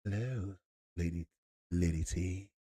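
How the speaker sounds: soft, low smooth vampire voice; a hint of a suave vampire; lightly voiced smooth Dracula; mock vampire greeting. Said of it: "I was like a vampire and I said".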